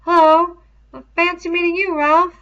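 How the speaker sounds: high, loud, fast and awkward; awkward twin greeting. A narrator's reading. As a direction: cover embarrassment with a rushed hello.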